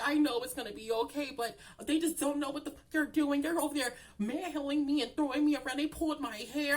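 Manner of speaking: high-pitched mock-tearful complaint; imitated whiny victim. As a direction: whimper out a list of grievances in imitation. Voices the crying woman complaining back.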